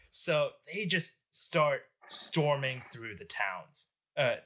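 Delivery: usual speaking voice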